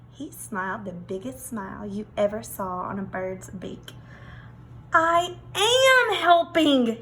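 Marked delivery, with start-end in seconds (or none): 4.9-7.0 slow, higher proud peacock; pleased thought. Acted. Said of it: "he thought".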